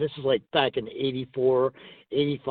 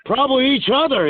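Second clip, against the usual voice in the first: high, loud teasing punchline; imitated high-pitched ribbing; 11 semitones higher, 5 dB louder than usual. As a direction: pitch it way up like a mocking jab.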